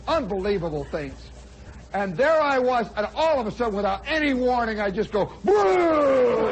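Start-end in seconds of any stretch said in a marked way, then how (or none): 5.4-6.5 loud, higher retching heave; mock retching noise. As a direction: burst out a mock vomit sound. Vomiting noise imitated.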